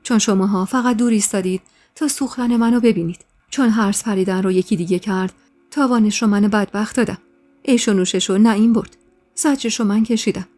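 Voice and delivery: usual speaking voice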